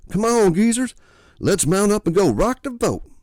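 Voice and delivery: loud high holler. Acted. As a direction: holler to rally the old folks.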